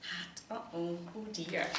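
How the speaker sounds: plain reading voice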